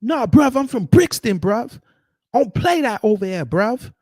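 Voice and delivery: mock London street accent, high and loud; hammed-up British roadman voice, lampooning. Mocking Brits who answer with their hometown, in a loud mock British accent.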